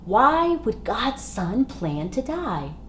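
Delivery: plain reading voice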